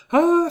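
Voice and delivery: very high, loud startled cry; pretty little shriek. A narrator's reading. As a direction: let out a dainty squeal of fright. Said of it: "en poussant un joli petit cri". (joli petit cri aigu)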